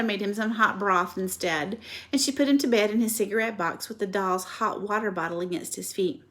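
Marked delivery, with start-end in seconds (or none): none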